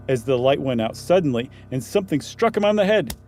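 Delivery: ordinary delivery, nothing marked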